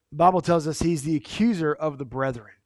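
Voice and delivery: ordinary talk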